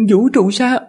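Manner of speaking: high feminine attendant voice; man doing a woman's voice. Acted. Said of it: "Lát ra giả giọng nữ".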